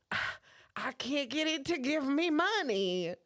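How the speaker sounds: plaintive Southern lady impersonation; Southern accent. Retold or imitated. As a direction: imitate a helpless Southern customer complaining. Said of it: "I did the accent".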